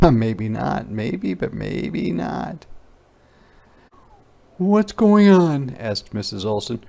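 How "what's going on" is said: high, louder, slow question; a teacher stepping in; high puzzled teacher; teacher asking. Acted. Teacher voiced.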